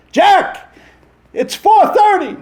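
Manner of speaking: high-pitched shout from the audience; interrupting yell at the actor on stage; heckling old lady. Retold or imitated. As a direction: call out loudly, high and insistent. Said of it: "some lady jumps up and goes".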